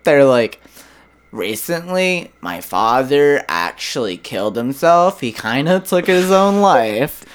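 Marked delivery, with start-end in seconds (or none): 1.3-7.2 mock valley-girl uptalk, phrases lifting at the end. Girl casually sharing grim family news, valley-girl lilt. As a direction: breeze through it in a put-on valley-girl accent. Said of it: "like some type of valley girl".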